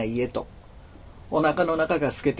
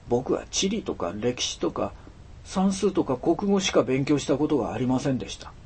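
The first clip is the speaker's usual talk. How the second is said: usual speaking voice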